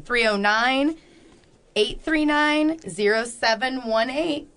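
spelling out each digit slowly; slow careful repeat. Shown as a demonstration. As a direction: read the number slowly for the listener. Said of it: "Let me say that again".

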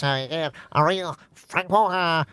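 pinched nose voice; nose-held nasal Australian. Retold or imitated. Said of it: "holds her nose".

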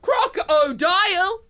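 isolated words with dramatic pauses; slam poetry. Acted. Host performing her Crocs poem, slam poetry. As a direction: deliver each word as weighty verse. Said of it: "so it's in that style".

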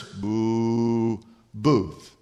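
low disapproving boo. Shown as a demonstration. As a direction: toss in a quick thumbs-down boo. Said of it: "put your thumb down and just go".

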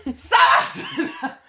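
high, drawn-out yell; imitated commanding shout; shouting instructor. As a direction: holler it slowly like a relentless yoga instructor. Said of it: "el weón me grita".

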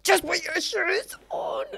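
high, pleading inner voice; exasperated inner plea. Retold or imitated. Mum's inner voice begging her daughter, high and pleading.

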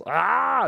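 high, drawn-out "aaah" without full force; mock yell. Podcaster retelling the fighter's yell, high mock yell. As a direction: imitate a yell, pitch swooping up. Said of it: "And then he just yells".

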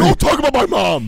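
high, loud burst of protest; indignant shout. Real, not acted. Son defending his mom to teasing co-hosts, indignant shout.

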